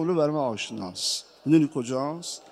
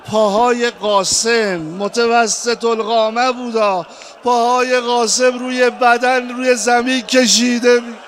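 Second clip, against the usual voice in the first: full-force lament; 6 semitones higher, 9 dB louder than usual. Acted. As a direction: wail the climax to the mourners. Lament resumes.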